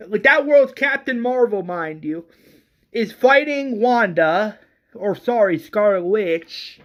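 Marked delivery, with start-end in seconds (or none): none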